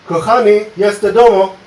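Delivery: loud, hammed-up mock foreign greeting; mock foreign husband. Husband home from work calls to wife, loud mock foreign voice. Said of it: "and he says".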